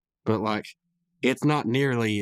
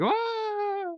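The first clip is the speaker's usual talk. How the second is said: mock fading scream. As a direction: imitate a long scream dying away. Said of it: "he's still screaming".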